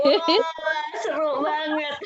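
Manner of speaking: high, loud laughing wah; host laughing at a guest's garden harvest; delighted laugh. Real, not acted. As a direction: laugh through the words with delight.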